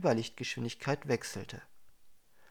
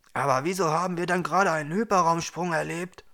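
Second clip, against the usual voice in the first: louder, higher baffled question; confused; 5 semitones higher, 7 dB louder than usual. A narrator's reading. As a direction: ask in bewildered urgency. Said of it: "fragte Marquardt verwirrt".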